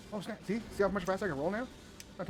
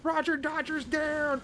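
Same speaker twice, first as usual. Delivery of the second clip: announcer call; 10 semitones higher, much slower than usual. Pretend.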